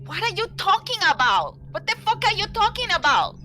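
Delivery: high, fast, loud alarmed shouting; shocked yelling. Acted. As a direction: yell it in total disbelief. Player shocked at teammate's panic.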